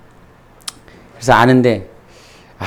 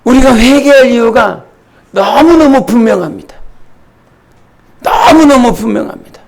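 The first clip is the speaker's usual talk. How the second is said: high-pitched, insistent pulpit delivery; emphatic preaching; 9 semitones higher, 4 dB louder than usual. Acted. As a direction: drive the point home to the congregation.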